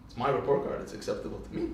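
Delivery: low, soft, deadpan reply; offhand comeback. Retold or imitated. Young Moshe answers mother about report card, offhand comeback. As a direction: answer back quietly, fading away.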